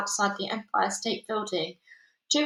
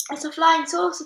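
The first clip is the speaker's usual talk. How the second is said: high, louder excited cry; shouting crowd; 7 semitones higher, 5 dB louder than usual. A narrator's reading. Crowd spots the peach, shouting in excitement. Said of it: "they shouted".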